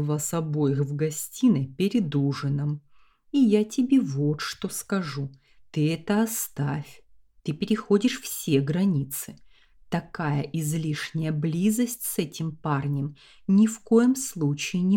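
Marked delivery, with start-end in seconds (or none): none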